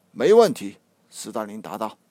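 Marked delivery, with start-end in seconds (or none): 0.1-0.8 loud, higher no-problem reply; confident. A narrator's reading. Stalin brushing off Lenin's worry, loud and sure. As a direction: bark it out with total confidence.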